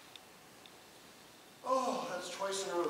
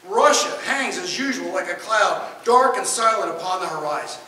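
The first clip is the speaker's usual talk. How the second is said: louder, grave reading of a gloomy editorial; dramatic reading; 3 semitones higher, 11 dB louder than usual. Acted. Lecturer reading a gloomy old magazine passage to the class, grave and loud. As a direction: read it like a doom-laden editorial.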